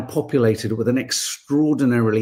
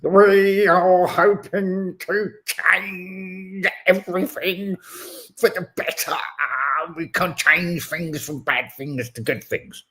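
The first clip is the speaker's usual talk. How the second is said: slow, higher droning politician voice; mock Starmer; 6 semitones higher, slower than usual. Mocking the PM's empty promise, slow droning voice. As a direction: drag it out like a dull earnest leader. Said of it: "He's like".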